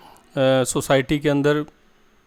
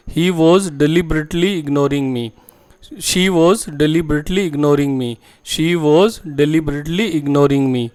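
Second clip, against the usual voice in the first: very slow and clearly spaced, word by word; slow model sentence; much slower than usual. Shown as a demonstration. Teacher to Hindi-speaking students, modelling the English sentence slowly. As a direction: spell the sentence out slowly for learners. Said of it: "इसके कुछ sentences समझेंगे".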